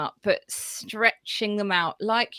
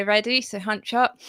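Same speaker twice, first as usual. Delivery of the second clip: ordinary talk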